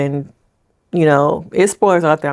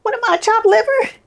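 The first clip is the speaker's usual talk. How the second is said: mock indignant. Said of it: "I'm like".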